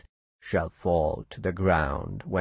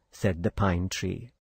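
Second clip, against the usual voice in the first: ordinary delivery, nothing marked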